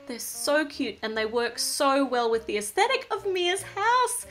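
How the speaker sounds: ordinary talk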